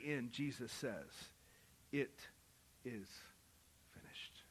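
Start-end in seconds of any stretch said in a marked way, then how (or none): 1.9-4.4 hushed, fading whisper of the last word. Acted. Preacher whispering Jesus's final words on the cross. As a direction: let "finished" die away in a whisper.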